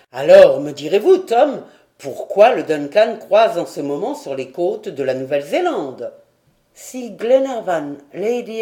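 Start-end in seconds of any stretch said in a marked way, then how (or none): 0.1-6.1 loud, lower-pitched demand. A narrator's reading. Lord asking sailor why the yacht is here, demanding. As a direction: press for an explanation.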